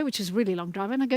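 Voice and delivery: usual speaking voice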